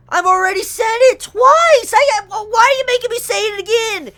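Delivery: hammed-up complaining to Rooster Teeth; mock exasperation. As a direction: whine it like you can't believe you have to say it. Mock exasperation at having to repeat praise.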